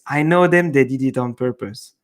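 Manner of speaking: normal talking voice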